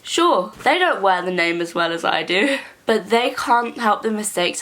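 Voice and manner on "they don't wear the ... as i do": ordinary talk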